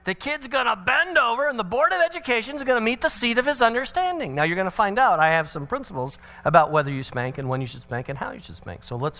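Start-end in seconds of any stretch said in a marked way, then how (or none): 0.1-4.3 higher, fast, worked-up retort; heated refusal. Retold or imitated. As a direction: brush her off all fired up. Father refuses wife, heated.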